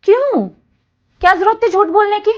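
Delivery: loud, high, indignant demand; angry confrontation. Acted. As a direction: demand an explanation for the lie. Daughter confronts mother over lie.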